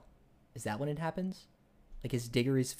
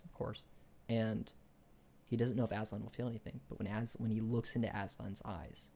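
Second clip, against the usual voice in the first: ordinary talk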